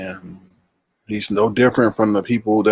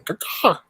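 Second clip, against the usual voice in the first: eagle cry; 7 semitones higher than usual. Pretend. Eagle call again.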